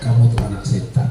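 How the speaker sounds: low, soft, syrupy-sweet demonstration; gentle mock mother. Shown as a demonstration. Mother gently telling child hurtful words, low mock-gentle voice. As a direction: say it softly and low, feigning tenderness. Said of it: "Lemah lembut ya".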